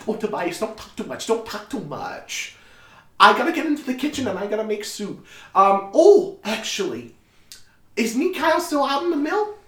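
high, bustling mother voice; high-pitched, busy motherly chatter; fussing mother. Acted. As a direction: chide your son and hurry on.

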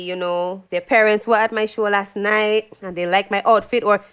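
ordinary delivery

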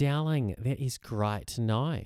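playful mock-Australian; Australian accent. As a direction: put on a drawn-out Australian "darling" voice. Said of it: "my Australian accent".

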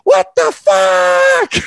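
high, drawn-out mock yell of the angry lady; high slow imitation of a furious shout; imitated yell. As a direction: bellow Ty's name like an outraged neighbour, slowly. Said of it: "all you could hear is".

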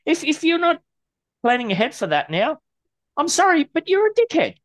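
high-pitched angry rant. Real, not acted. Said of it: "you ranting and raving in the lisp".

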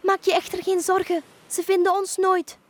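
high, loud, confident dolphin voice; reassuring dolphin. Acted. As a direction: reassure her as a sure dolphin. Dolphin reassures witch after foghorn. (geruststellende, hoge dolfijnenstem)

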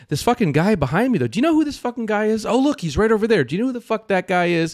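imitating Ted demanding who it was; hammed-up indignant question; mock incredulous. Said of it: "he's like".

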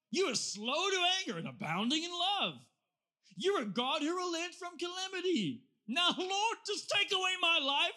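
loud, high, whining dramatic complaint to God; angry prayer. Acted. Prophet railing at God in a loud, high, theatrical angry prayer. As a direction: overact Jonah's sulking outburst, voice cracking high. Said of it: "This is an angry prayer".

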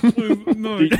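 slow, laughing through the words; cracking up at the bit. Real, not acted. Laughing at their own bit.

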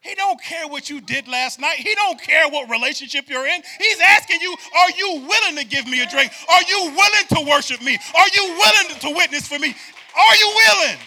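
loud, high, ringing preacher call; hammering the repeated "if"; preaching at full force. Acted. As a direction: build the congregation up with fire.

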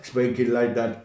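low, dragged-out imitation of an actor; not speaking normally. Mocking an actor's delivery late in the film, low and drawn out. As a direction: imitate him slowing right down, pitched low. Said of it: "he's not really speaking normally".

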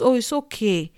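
ordinary talk

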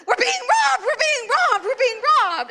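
high, loud panicked yelling, half laughing; panicked hollering. Retold or imitated. Woman yelling a warning to shoppers as she runs out, high and loud. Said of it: "hollering, I'm like".

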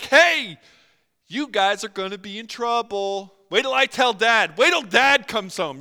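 high, loud tattletale sing-song; childish taunt. Retold or imitated. Preacher voicing enemies as a tattling kid, loud and high. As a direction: taunt like a bratty sibling.

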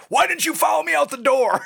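high shouted accusation; bratty angry demand. Retold or imitated. Nineteen-year-old blaming girlfriend in the car, angry shout. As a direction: blame her like a petulant teen. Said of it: "I'm like".

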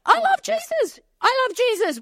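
very high, gushing enthusiasm; hammed-up eager outburst; excited declaring. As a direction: blurt it high, bursting to join in. Acts joining in excitedly.

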